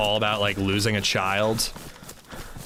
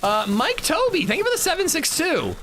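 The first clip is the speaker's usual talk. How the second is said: surprised; 13 semitones higher than usual. Real, not acted. Surprised by a donation.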